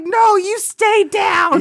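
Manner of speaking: commanding shout. Retold or imitated. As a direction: hold him down with your voice. Wife ordering him to stay.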